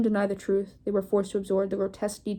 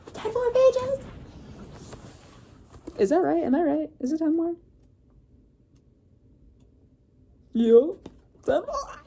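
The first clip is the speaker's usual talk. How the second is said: excited; 9 semitones higher than usual. Real, not acted. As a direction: cheer it out with a laugh. Reader's excited aside about pages.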